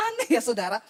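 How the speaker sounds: said through a real laugh. Real, not acted. Preacher remarks to congregation on odd healers, laughing. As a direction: let the chuckle spill into the words.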